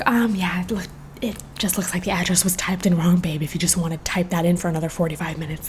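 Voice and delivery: hushed sarcastic aside to a friend; quiet snarky murmur so the clerk won't hear; whispered sarcasm. Retold or imitated.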